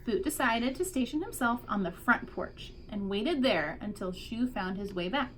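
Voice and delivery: usual speaking voice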